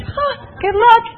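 high, mocking "good luck"; sarcastic. Doubting friends mocking her plans with a high sarcastic jab. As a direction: toss off a scornful high quip.

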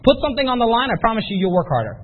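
ordinary delivery

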